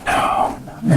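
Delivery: unvoiced, horrified little no; mock dismayed boss. Boss watching the student's talk fail, mock whispered dismay. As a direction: imitate a boss silently pleading in dismay.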